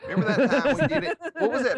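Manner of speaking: squeaky laughing question; laughing high voice. Real, not acted.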